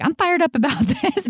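high-pitched imitation of being fired up; mock rant. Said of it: "you can tell I was on a rant".

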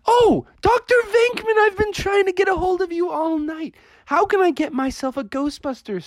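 very high, excited pleading; eager dad. Acted. Dad spots Doctor Venkman and wants suit, eager. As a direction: squeal it high with sudden recognition. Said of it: "Froggy's dad was like".

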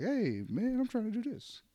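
squeaky high voice, acting timid; high mock whine; mock high-pitched voice. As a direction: pitch way up like a meek guy. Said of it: "I'm over here like".

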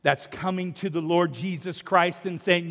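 ordinary delivery

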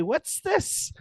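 put-on high, questioning little-girl voice; toddler voice. Uncle imitating his niece asking about a toy, high toddler voice. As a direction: mimic a two-year-old holding up a toy. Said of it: "come to you and say".

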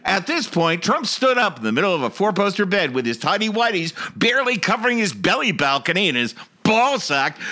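usual speaking voice

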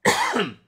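loud throat-clearing cough; harsh real cough; chesty cough. Real, not acted. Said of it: "Scusate, ma c'ho sta tosse".

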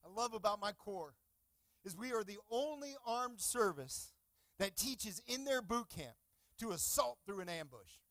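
ordinary talk, nothing marked

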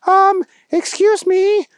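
very high, prissy shocked manager voice; indignant manager. Acted. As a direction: squeak it out high, outraged at the agent. Pharmacy manager confronts agent.

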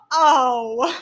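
a loud, blunt oh; flat oh. Retold or imitated. Imitating dad's deadpan reaction to the joke, loud. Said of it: "My dad's response as soon as I said that was".

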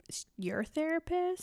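high, soft mock-incredulous question; near-whispered retort, voice lifted high; hushed disbelief. [name] needling Bill about his fiancée, mock hushed disbelief.

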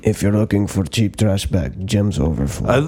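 put-on Russian accent. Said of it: "to Russian accents".

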